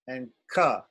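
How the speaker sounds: "ka" with a big puff of air; aspirated kha. Shown as a demonstration. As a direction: breathe out hard on it. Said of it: "There's more air".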